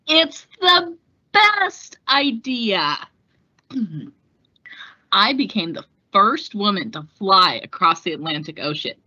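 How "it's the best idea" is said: slow, higher, proud answer; confident retort. Acted. Amelia answering doubters, slow proud confidence. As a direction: savour every word of it.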